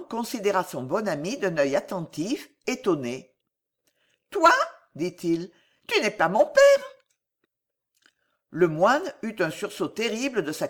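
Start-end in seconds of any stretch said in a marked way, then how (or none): 4.3-4.5 put-on high child's voice, taken aback; astonished little boy. A narrator's reading. Astonished child answers monk. 5.9-6.8 put-on high, loud child objecting; astonished little boy. A narrator's reading. Astonished child answers monk.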